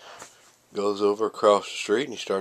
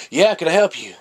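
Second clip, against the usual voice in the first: louder, higher, mildly put-out reply; mock interrupted annoyance; 8 semitones higher, 8 dB louder than usual. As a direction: act lightly bothered at being interrupted. Interrupted man answers tug on leg.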